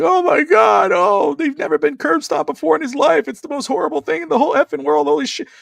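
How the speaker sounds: over-the-top shrieking Heyman impression; Paul Heyman freakout. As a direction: ham up a panicked manager wailing. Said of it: "Paul doing the normal Paul thing".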